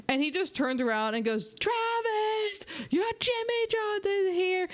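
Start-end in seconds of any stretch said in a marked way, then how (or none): 1.6-4.7 much higher, slower call across a packed room; calling across a party. Retold or imitated. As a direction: yell it over the crowd to Travis. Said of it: "now everyone is screaming".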